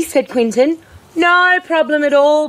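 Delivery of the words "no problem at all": high, put-on dog voice; lightly hammed-up friendly dog; reply as a dog, pitched well up; dog character. A narrator's reading. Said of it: "the dog replied".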